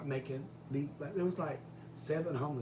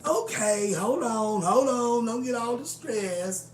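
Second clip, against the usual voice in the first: mock boss placating; 8 semitones higher, 11 dB louder, slower than usual. Boss trying to calm her.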